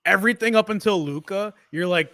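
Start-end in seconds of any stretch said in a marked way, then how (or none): none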